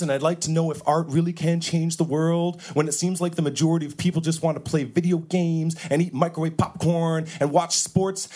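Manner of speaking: pretentious poet customer. Acted. Poet customer orders dramatically.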